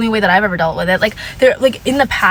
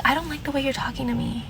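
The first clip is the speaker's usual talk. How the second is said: calm reply; 4 semitones higher, 10 dB quieter, faster than usual. Retold or imitated. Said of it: "I was like".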